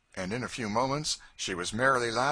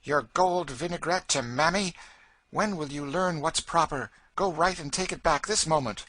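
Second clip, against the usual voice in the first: loud, higher impatient mother; mother ordering her daughter to return the gift, loud and impatient; impatient scolding; 10 semitones higher, 8 dB louder than usual. A narrator's reading. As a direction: scold the child sharply. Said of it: "stamping impatiently".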